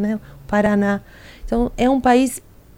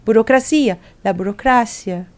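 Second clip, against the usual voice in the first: ordinary talk, nothing marked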